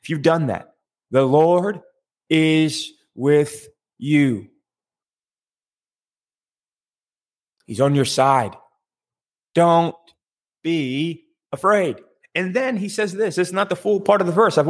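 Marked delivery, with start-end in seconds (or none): 1.1-12.0 penekanan lambat. Real, not acted. Slow, spaced-out reassurance to listeners.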